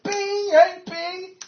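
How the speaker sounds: hammed-up oblivious boss; cheery boss. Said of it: "He was like".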